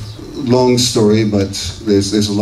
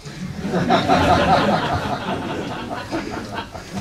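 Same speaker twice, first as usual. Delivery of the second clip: long, breathy laugh; 8 semitones higher, 5 dB quieter than usual. Real, not acted. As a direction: laugh at your own attempt. Laughing at own weak accent attempt.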